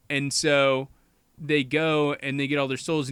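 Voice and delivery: normal talking voice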